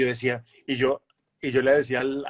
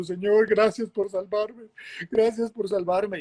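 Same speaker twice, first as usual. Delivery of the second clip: higher, slow, tearful retold thanks; tearful prayer; 8 semitones higher, slower than usual. Thanking God after the retreat, lightly imitated tearful prayer. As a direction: pray through tears, a touch imitated. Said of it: "llorando y diciendo".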